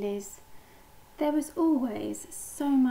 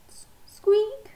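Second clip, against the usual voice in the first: high, loud little squeak; 11 semitones higher, 7 dB louder than usual. A narrator's reading. Pip squeaks at the birds, high squeak. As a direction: squeak it like a tiny duckling. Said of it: "squeaked Pip".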